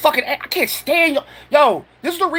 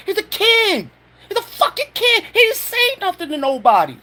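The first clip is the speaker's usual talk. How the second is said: higher, exasperated cry of outrage; indignant outburst; 6 semitones higher than usual. Real, not acted. Ranting at the government over a teenager, outraged and higher. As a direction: pitch jumps up in disbelief at the accusation. Angry rant defending the boy.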